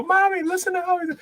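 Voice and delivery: put-on high excited little boy voice; child voice. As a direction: son calling his mother to listen, high and giddy. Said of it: "He'll, like, go".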